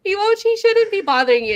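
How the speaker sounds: high, loud, through laughter; laughing retelling. Retold or imitated. Assistant joining the mob boss joke, laughing. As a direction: crack up while quoting the boss.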